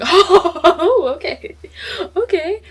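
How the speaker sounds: high squeaky laugh through the words; flustered, giddy oh okay. Real, not acted. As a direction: giggle it out, caught off guard.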